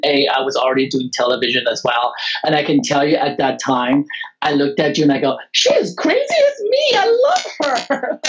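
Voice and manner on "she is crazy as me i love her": very high, squealing, half-crying delight. Retold or imitated. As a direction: gush over her like a fan. Host voicing her own delight.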